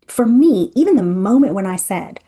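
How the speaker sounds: ordinary talk, nothing marked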